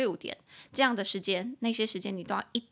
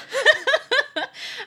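loud squealing laugh; real laugh after the imagined comeback; high burst of laughter; 9 dB louder than usual. Real, not acted. Laughs at own retort.

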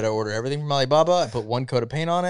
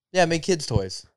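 normal talking voice

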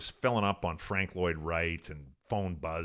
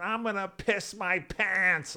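high, slow, wailing mock scream; mock drunk scream; 12 semitones higher, slower than usual. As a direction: ham up a drunk girl's wail, drawn out. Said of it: "She starts screaming".

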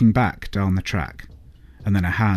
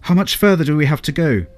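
higher, louder anxious call; shouting; 9 semitones higher, 7 dB louder than usual. A narrator's reading. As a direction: shout ahead to the driver. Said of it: "Thelonious shouted to Crum".

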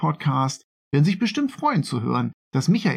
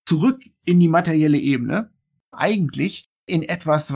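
ordinary delivery

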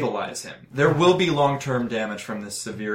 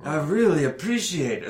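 put-on character voice, weakly thankful; dazed grateful man; 3 semitones higher than usual. Injured man thanks doctor for helping puppy, dazed gratitude. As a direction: give thanks in a slightly raised, woozy voice. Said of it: "I don't think he's lucid enough to really say".

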